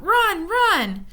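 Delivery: high, loud warning cry; distant voice crying a warning; cried out. A narrator's reading. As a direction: call out urgently from afar. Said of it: "a voice cried out".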